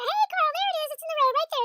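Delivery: high, loud excited alert; alarmed calling out. Retold or imitated. Spotting the raccoon from the car, high excited alert. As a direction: shout out the sighting. Retold sighting, calling out to neighbor.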